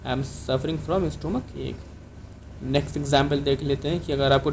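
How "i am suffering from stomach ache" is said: slow model sentence. Shown as a demonstration. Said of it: "या आप इसको इस तरह भी कह सकते हैं".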